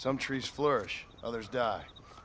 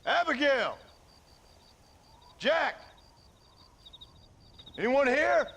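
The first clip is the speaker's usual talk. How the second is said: calling out; 10 semitones higher than usual. Acted. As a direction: shout for your family. John calls for family at home.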